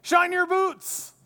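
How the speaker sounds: slow, high, loud bark; drill sergeant. Retold or imitated. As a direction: yell it like a drill instructor. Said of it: "like a drill sergeant".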